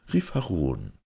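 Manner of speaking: ordinary delivery, nothing marked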